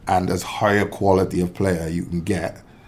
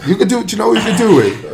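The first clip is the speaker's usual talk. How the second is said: excited; 13 semitones higher, 7 dB louder, faster than usual. Real, not acted. Excited interruption with idea.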